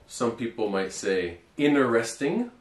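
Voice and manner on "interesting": interesting with the t dropped, in-er-esting; American in-er-esting; no-T version. Shown as a demonstration. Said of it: "an optional pronunciation".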